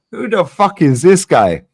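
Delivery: high, loud "who the fuck is this guy"; loud disbelief. Retold or imitated. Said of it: "and I was like".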